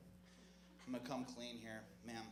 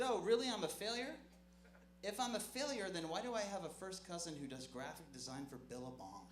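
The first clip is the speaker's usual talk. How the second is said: higher, indignant "Oh, really?"; indignant retort; 5 semitones higher than usual. Retold or imitated. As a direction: fire back at the ones calling him a failure. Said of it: "I said".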